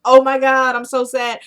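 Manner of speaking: high, loud mock dismay; hammed-up sorrow, starting loud and easing off; mock distraught. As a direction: act out an over-the-top upset reaction. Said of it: "didn't really expect him to be".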